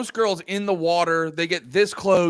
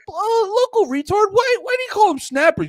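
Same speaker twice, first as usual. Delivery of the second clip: high, wondering interviewer voice; hammy high-pitched journalist; mock lady reporter; 3 dB louder than usual. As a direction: ask it way up high as a curious reporter. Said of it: "just some lady from".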